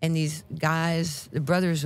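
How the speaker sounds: ordinary delivery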